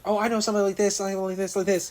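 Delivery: eager matchmaker. Retold or imitated.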